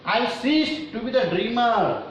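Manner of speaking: high, slow declaimed verse; poem recitation. Real, not acted. Teacher reads poem's line to students, high slow declamation.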